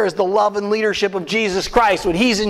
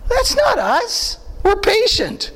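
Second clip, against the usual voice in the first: much higher, slow, sugary calm; sweet, mock-serene; 11 semitones higher, slower than usual. Preacher mock-serene contrast.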